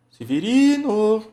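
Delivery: high, loud, drawn-out name call; singsong call stretching the stressed syllable; calling someone out. Shown as a demonstration. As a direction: holler for a neighbour from the gate. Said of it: "do mesmo jeito que você chama o Severino".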